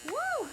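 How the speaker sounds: excited high whoop; high cheer. Real, not acted. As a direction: let out a happy whoop. Cheer after the set.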